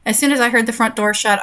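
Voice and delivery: normal talking voice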